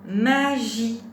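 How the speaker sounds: loud, deliberate teacher's model word; syllables held evenly and loudly; word dictation. Shown as a demonstration. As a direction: project each syllable clearly for a learner.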